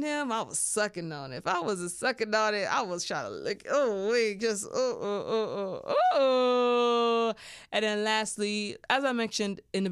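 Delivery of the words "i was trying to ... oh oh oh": breathy mock moans climbing louder and higher; mock moaning. To listeners, acting out her own pleasure: hammed-up moaning. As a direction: hams up moaning sounds mid-story. Acting out oral sex mid-story.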